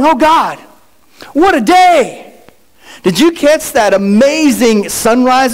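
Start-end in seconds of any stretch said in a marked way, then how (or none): 0.0-2.3 high, bright, eager exclamation; joyful praise. Acted. Waker thrilled by the new day, bright joyful cry. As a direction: greet the morning with delight. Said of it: "And instead of going".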